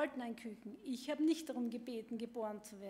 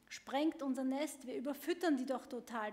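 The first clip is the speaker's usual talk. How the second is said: ordinary talk, nothing marked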